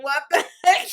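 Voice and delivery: loud, high exclamation; retold shock. Friend reacting to the sent video, loud disbelief. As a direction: burst out in mock bewilderment.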